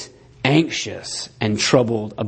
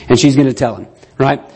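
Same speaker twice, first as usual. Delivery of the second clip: normal talking voice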